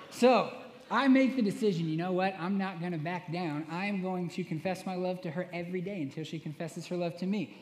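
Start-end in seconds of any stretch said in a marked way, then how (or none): none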